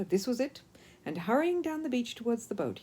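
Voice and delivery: ordinary delivery, nothing marked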